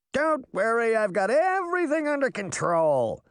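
high, breezy boast; cocky high-pitched reassurance; carefree fairy boy. A narrator's reading. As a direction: brag it in a high boyish voice. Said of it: "Puck said as he flew casually".

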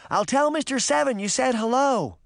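put-on high little-girl call; high-pitched voice carrying over distance. A narrator's reading. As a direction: call a parting message back to friends. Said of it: "Daphne called".

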